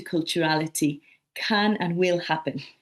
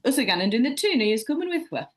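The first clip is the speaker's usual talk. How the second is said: higher, broad Mackem accent; Sunderland dialect; 5 semitones higher, faster than usual. Shown as a demonstration. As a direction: say it like a local from Sunderland. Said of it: "How do we say it?".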